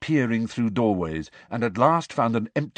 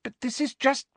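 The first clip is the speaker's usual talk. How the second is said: high-pitched, faltering plea; desperate; 14 semitones higher than usual. A narrator's reading. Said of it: "said Hermione desperately".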